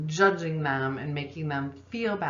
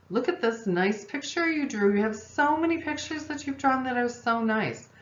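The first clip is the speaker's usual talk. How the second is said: much higher, gushing mom voice; overeager consoling mom; 7 semitones higher than usual. Retold or imitated. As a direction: pile on the praise, way up high. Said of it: "being the consoler".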